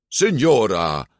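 called out in feigned indignation; mock anger. A narrator's reading. Ercole answers the signora about his age, mock anger. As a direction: play offended, lightly exaggerated. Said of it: "riep Ercole met gespeelde boosheid".

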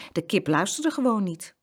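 ordinary delivery

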